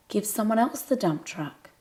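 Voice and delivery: plain reading voice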